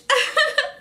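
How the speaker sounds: high laugh through tears; tearful laugh. Real, not acted. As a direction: laugh while trying not to cry.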